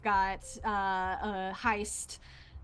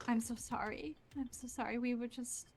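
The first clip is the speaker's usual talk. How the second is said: soft, higher, on the edge of tears; tearful mother; 3 semitones higher, 7 dB quieter than usual. Acted. Mother hugging daughter after police station, tearful. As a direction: apologize through held-back tears. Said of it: "her crying a little bit".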